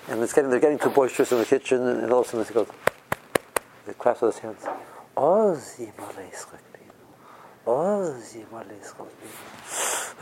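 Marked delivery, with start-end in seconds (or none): 5.2-10.2 soft whispered imitation of the rabbi, slow and drawn out; gentle whisper. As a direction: breathe it gently like a rabbi who never raised his voice. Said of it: "he would talk like this".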